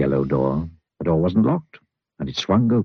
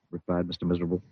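ordinary delivery

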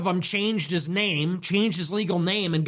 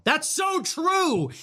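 slow, high emphatic shout; drawn-out shout; 9 semitones higher, much slower than usual. Acted. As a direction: stretch it out and shout it. Drawn-out catchphrase.

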